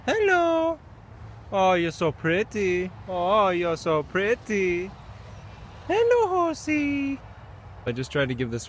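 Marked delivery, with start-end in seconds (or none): 0.1-7.2 high, slow cooing sweet-talk. Real, not acted. Cooing to a horse.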